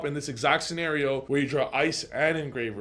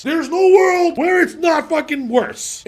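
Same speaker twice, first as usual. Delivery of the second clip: much higher, louder, slow hammering rant; slow emphatic shout; 14 semitones higher, 10 dB louder, slower than usual. Real, not acted. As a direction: pound every word home. Ranting about sequencing first.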